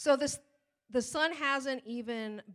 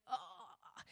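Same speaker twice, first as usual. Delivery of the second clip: exasperated ugh; 13 dB quieter than usual. Retold or imitated. Frustrated groan, searching for daughter.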